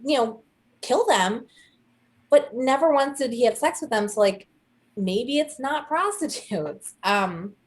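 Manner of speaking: usual speaking voice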